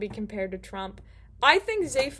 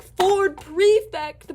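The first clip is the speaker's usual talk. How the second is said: high, loud, slow hail of a name; drawn-out delighted greeting; 8 semitones higher, 8 dB louder, much slower than usual. Retold or imitated. Zaphod hailing his cousin Ford across the ship, drawn out and delighted. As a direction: stretch the name out like greeting an old buddy.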